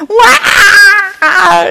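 loud high fake baby cry; mock baby wail. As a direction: wail like a scared toddler. Child crying at panicked mom.

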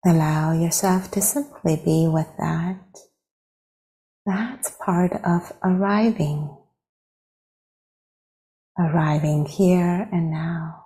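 unhurried phrases with long silences between; guided meditation. Real, not acted. Teacher leading meditators into arriving with the breath, guided meditation. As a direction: guide gently, leaving space after each phrase.

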